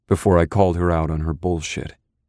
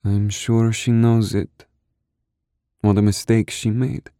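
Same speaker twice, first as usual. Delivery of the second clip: slow, higher, muffled female voice; muffled, slow woman's voice; 5 semitones higher than usual. Acted. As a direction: say it into his chest, slowly. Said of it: "her muffled voice".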